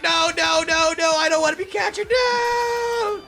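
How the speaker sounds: high, loud wailing cries of no, stretched at the end; crying ghost plea. Acted. The ghost begging not to be caught, wailing for Melody. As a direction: wail like a ghost being captured. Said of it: "He's like".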